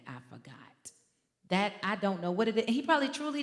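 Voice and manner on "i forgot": much softer, mumbled lazy excuse; mock the son's limp mumble. Mother mimicking her son's excuse, soft mumble. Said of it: "that ends up being his famous line".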